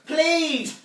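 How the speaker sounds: loud, high-pitched begging yell; desperate child's plea. Acted. Child refusing to stop asking mum, yelled plea. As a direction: demand it like a pestering child.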